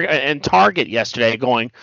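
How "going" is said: normal talking voice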